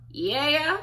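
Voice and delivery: a lightly voiced frail old woman; tiny elderly voice. A tiny old woman answering "Amy?". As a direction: squeak a small old lady's "yeah". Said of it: "tiny yet elderly voice go".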